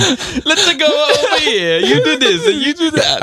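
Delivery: high, slow, laughing mock Italian accent; mocking Mario's Italian accent, laughing. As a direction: stretch it out like a silly cartoon Italian. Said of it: "Italian accent".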